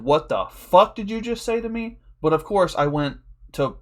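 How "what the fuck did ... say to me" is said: raised pitch, stressed FUCK; mock outrage, as only the eyes would say it; acted affronted disbelief, pitched up; indignant inner reaction. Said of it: "in my eyes, I said".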